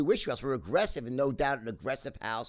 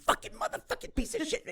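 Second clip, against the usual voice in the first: much higher mock furious cursing; Mad Max rage. Son imitating his raging father, mock furious cursing. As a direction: spit out the curses like an enraged father. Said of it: "He'd be".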